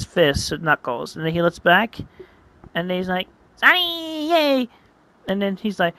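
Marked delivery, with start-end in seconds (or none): 3.6-4.7 high, drawn-out creature cheer; cheering hatchling. Acted. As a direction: cry out high like a newborn mascot. Hatchling's first cry.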